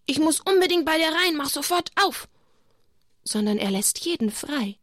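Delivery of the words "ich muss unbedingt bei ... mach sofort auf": a lightly voiced insistent guest, higher; hammed-up pushy knocking at the door; mock pushy demand. Teaching children what Jesus does not say, mock pushy. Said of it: "Er sagt nicht".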